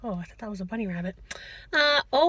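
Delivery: ordinary delivery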